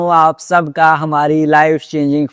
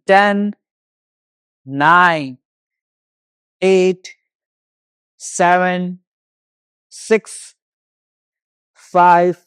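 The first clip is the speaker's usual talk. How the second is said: single counts, raised pitch, long gaps; exercise countdown; 4 semitones higher, much slower than usual. Real, not acted. Yoga teacher counting down jogging for the class, instructor's countdown. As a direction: call each number out on the beat.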